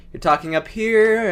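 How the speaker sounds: "up here" held up in pitch. Shown as a demonstration. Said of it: "you can go down to here".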